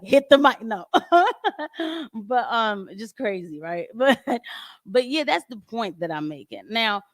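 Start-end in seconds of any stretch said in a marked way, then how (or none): 0.0-0.6 lightly voiced devil, higher and louder; mock command from the devil; devil's urging. Devil telling the speaker to hit the mic, mock urging. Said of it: "the devil was like".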